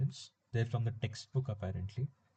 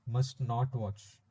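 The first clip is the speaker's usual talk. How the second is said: slow dictation; much slower than usual. Shown as a demonstration. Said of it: "if I'm conjugating must".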